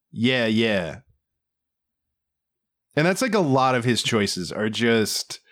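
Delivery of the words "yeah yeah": flat deadpan. Retold or imitated. Imitating the actor's odd reply after a pause, flatly. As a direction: imitate a detached, lower-pitched delivery. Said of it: "he completely flatly just goes".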